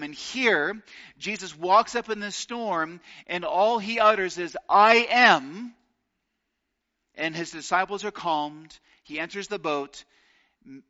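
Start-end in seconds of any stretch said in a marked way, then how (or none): 4.7-5.7 I am rings out loud and high; loud ringing I am over the waves; proclaimed. Acted. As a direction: call it out over the storm. All he utters is.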